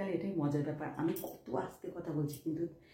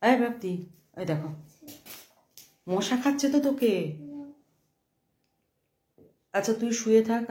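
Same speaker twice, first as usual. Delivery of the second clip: loud, raised call across the room; mother calling her daughter, loud and high; 5 semitones higher, 6 dB louder than usual. Real, not acted. Mother calls to daughter in bed.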